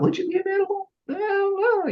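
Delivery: very high, unsure squeak; high doubtful tone. Shown as a demonstration. Coach mimics doubt creeping into the caller's voice. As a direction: voice climbing with doubt. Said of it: "If there's a slight bit of doubt".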